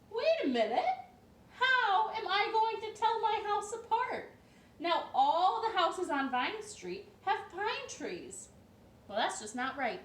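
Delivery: a flustered man noticing his house blends in; upset Mister Pine. A narrator's reading.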